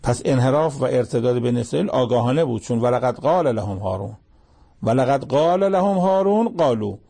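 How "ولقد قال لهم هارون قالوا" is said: chanted Quranic emphasis, stepping up in pitch; raised emphatic recitation. Shown as a demonstration. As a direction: lift the verse higher and chant it out. Verse repeated with emphasis.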